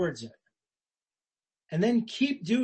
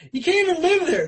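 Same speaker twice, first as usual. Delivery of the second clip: high and loud, exclaiming; emphatic exclamation; 11 semitones higher, 6 dB louder, faster than usual. Real, not acted. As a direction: throw it out to the congregation with full force. Preacher's emphatic exclamation on failure.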